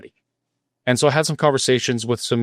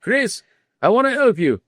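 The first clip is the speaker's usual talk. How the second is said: high, put-on Swiss accent, half-hearted; mock Swiss accent; 12 semitones higher than usual. Retelling the Swiss man's call to Chris, mock Swiss accent. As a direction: imitate a Swiss immigrant's thick accent, higher. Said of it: "he said in his thick accent".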